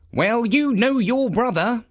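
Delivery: a dutiful steward defending his work; Dusty the steward. A narrator's reading. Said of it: "said Dusty".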